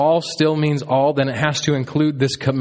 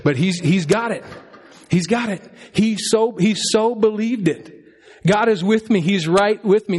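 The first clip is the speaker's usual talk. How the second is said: higher, thrilled retelling; excited; 9 semitones higher than usual. Real, not acted. Father telling the church about his son, excited. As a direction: beam with pride as you tell it.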